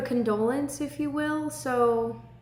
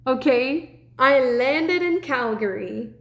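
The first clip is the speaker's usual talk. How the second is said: excited; 3 semitones higher, 6 dB louder, slower than usual. Real, not acted. Excited retelling of Calgary airport.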